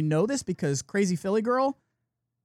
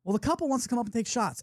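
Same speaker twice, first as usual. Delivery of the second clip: usual speaking voice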